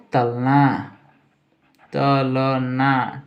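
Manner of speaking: तलना drawn out very slowly as "ta-la-naa"; slow dictation. Shown as a demonstration. Teacher writing out the meaning of fry, slow syllabic dictation. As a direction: stretch every syllable of तलना.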